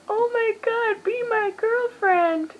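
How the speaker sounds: put-on very high, squealing, slowing on girlfriend; thrilled squeal. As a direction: burst out like the best news ever. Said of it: "and I'm like".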